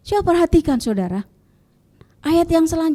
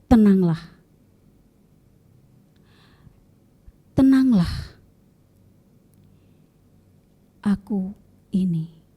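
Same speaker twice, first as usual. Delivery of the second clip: gentle; 5 semitones lower than usual. A narrator's reading. Jesus calming his frightened disciples, gentle and hushed. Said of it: "menjawab murid-muridnya dengan lembut".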